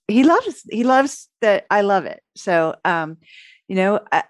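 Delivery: usual speaking voice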